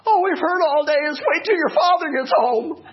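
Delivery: put-on high, loud sing-song kids' taunt; high mocking kid voice; mimicking the kids. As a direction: tease like the children would. Kids warning of father, mimicked.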